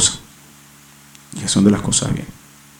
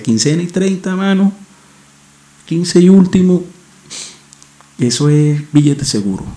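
higher pitch, quoting a pal's tip; lightly acted, drawn-out high quote; lightly voiced buddy; 8 semitones higher than usual. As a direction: imitate a friend sharing his sure thing. Quotes the friend's money advice.

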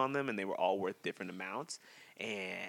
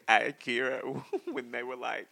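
laughing; 3 semitones higher, 4 dB louder, slower than usual. Retold or imitated. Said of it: "I was cracking up".

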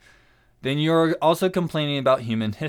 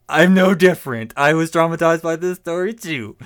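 laughing; 2 semitones higher, 7 dB louder than usual. Real, not acted. Laughing at own trauma.

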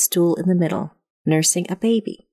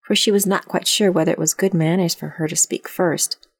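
ordinary delivery

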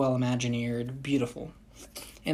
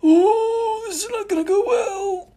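mock wail; 6 dB louder than usual. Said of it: "Allie and I are like".

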